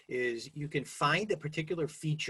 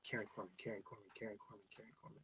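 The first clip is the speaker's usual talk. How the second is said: murmured name drill, much softer, trailing to a whisper; repeating under breath; 2 semitones lower, 15 dB quieter, much faster than usual. Shown as a demonstration. Speaker showing how to memorize a name, murmured repetition. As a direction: mutter the name over and over to yourself. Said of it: "You know, in your head".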